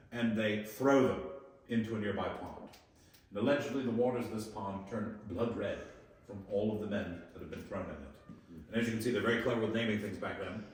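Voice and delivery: usual speaking voice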